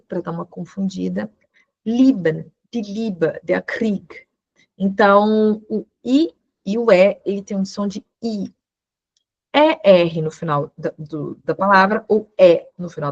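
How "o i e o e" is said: ordinary talk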